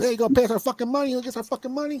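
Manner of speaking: very high, fast mobster rant; hammed-up high-pitched mafia demand; Joe Pesci. Mafia debt collector demanding money, mock mobster rant. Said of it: "who's this fucking Joe Pesci?".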